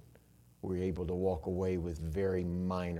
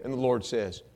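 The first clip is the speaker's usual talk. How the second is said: normal talking voice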